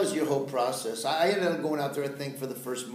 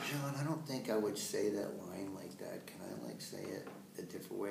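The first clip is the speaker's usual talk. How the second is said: whispered, timid request; hushed whisper; 3 semitones lower, 8 dB quieter than usual. Retold or imitated. Newcomer quietly asks the director, whispered. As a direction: ask it under your breath. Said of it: "I was like".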